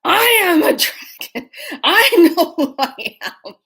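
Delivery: hammed-up dragon pride, laughing; haughty dragon. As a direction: proclaim it grandly like an offended dragon. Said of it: "she says".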